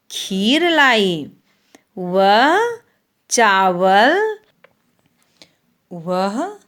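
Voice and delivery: slow, sing-song primer reading, long vowels stretched; reading to children. Acted. Teacher reads the Hindi primer to young pupils, slow and sing-song. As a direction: read each word slowly for the class to repeat.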